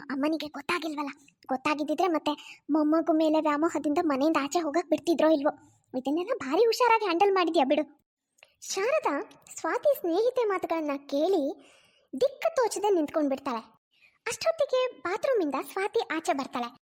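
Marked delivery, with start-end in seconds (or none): none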